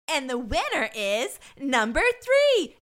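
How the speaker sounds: loud, high mock game-show reveal; hammed-up, slow, smirking proclamation; smirking announcement. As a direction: draw it out like announcing a winner. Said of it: "kinda smirks and goes".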